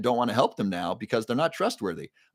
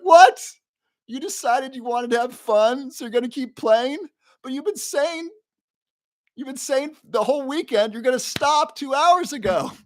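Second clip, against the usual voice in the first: much higher, squeaky incredulous voice; outraged disbelief; 4 dB louder than usual. Retold or imitated. Telling the DJ he's broken his word, high-pitched outrage.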